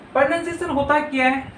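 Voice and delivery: normal talking voice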